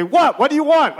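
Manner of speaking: high, loud mock irritation; hammed-up impatient stage reply; acted exasperated reply. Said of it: "Guildenstern again will say".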